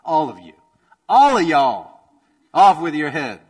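hammed-up furious king shouting off with your head; angry royal order. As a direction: bellow the order like a tyrant who's had enough. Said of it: "He's angry, and he's like".

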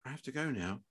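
very soft, hushed doctor; softly. A narrator's reading. Doctor excusing herself from the parents, softly. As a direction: drop right down to a hush. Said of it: "said the doctor softly".

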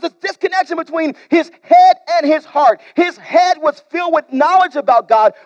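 loud, forceful preaching; preaching shout. Real, not acted. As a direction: drive the point home with a shout. Preacher's climax.

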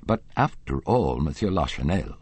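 ordinary delivery

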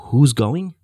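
loud, challenging repeat of a question; incredulous echo. Retold or imitated. God's side answering Pharaoh's haggling, incredulous. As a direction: throw the question back loudly. Question thrown back in disbelief.

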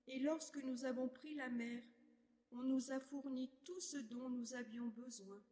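usual speaking voice

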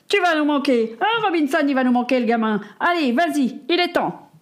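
a burly cook hiding his emotion in bluster; jolly big cook. Acted. Big cook hugging the boy.

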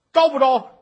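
stern judge thundering for a confession; loud demand. Acted. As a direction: bellow the question at the prisoner.

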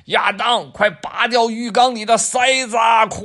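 high, loud frantic shout; urgent yell. Acted. Dad yelling at Adam in the bath, loud urgent shout. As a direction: yell through the door to stop the flood.

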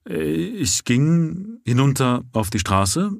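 lightly voiced flustered policeman; constable's voice. A narrator's reading. Constable Rance voiced in story.

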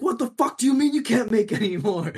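high, loud furious customer rant; mock customer yell. Retelling the customer screaming at the barista, loud mock rage. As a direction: shout it up high like the outraged regular. Customer yelling about pastries.

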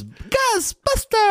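high stretched excited exclaim; drawn out, thrilled shout. Real, not acted. Excited movie title shout.

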